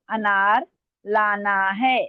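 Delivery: slow, evenly spaced words; slow dictation. Real, not acted. Teacher completing the sentence for students, slow dictation. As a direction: read it out for slow writers. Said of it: "मैं आराम आराम से बोल रही हूँ".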